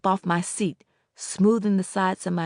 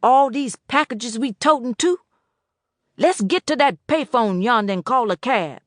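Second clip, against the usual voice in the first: put-on old Boatwright voice; 5 semitones higher, 5 dB louder than usual.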